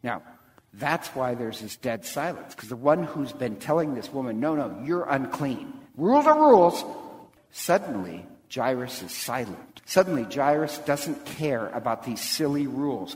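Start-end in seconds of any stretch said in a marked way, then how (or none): 4.4-6.8 strict enforcer. Acted. Jairus refusing the unclean woman, loud strict retort. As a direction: snap the rule at her.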